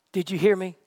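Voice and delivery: usual speaking voice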